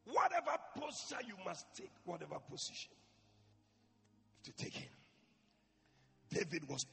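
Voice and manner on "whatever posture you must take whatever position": usual speaking voice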